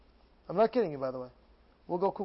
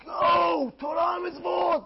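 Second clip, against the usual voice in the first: high, loud exclaiming zeal; stretched, high-pitched acted rapture; ecstatic cry; 9 semitones higher, 4 dB louder, much slower than usual. Acts out crazed enthusiasm.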